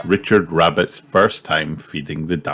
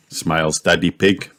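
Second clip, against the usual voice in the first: usual speaking voice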